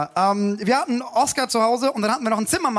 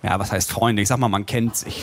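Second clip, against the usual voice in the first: imitated wise old man in a child's body, much lower; old soul; 12 semitones lower than usual. Son answers his father about appliance friendships, old-soul voice. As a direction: play a little boy as a low-voiced old sage. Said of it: "eine alte Seele".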